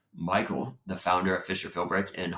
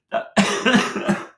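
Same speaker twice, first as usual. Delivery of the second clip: raspy cough; 13 semitones higher, 7 dB louder than usual. Real, not acted. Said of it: "I sound like a creepy old smoking man".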